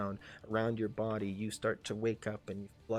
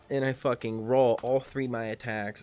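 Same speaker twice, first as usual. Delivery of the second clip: normal talking voice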